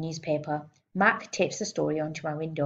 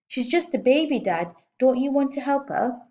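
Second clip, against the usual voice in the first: put-on higher, louder pleading girl; 7 semitones higher, 4 dB louder than usual. A narrator's reading. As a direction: appeal to his heart.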